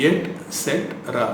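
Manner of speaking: et cetera broken as et, set, ra; syllable by syllable. Shown as a demonstration. Teacher breaking the word down, syllable by syllable. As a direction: say et cetera in separated pieces. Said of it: "டி sound தான் வரணும்".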